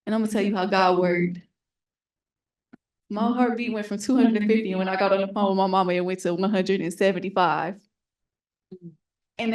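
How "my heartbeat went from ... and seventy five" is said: ordinary talk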